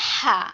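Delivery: হা pressed from mid-throat; squeezed-throat pronunciation. Shown as a demonstration. Teacher names the second mid-throat letter, tight-throated হা. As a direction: squeeze out a single হা. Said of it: "এই দুইটাই গলা চেপে উচ্চারণ হবে".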